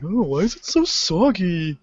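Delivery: a hint of a confused dad; puzzled parent. Parent sitting on the wet lifeguard chair, mildly put-on puzzled voice.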